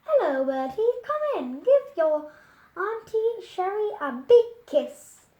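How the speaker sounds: a hint of a loud, gushing neighbour; lightly voiced gushing aunt. A narrator's reading. Sherry greeting Bertie at the door, gushing and loud. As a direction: Auntie Sherry booming her welcome.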